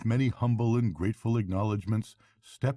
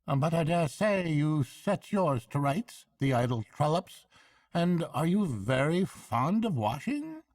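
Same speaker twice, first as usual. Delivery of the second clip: high, hearty barge woman; bright country woman chatting; 10 semitones higher, 3 dB louder than usual. A narrator's reading. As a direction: barge woman offering a lift.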